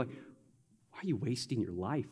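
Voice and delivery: low, soft, slow imitation of a critic; acted low-voiced disapproval; hushed questioning. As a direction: drop right down as if quietly judging. Voices onlookers questioning her devotion.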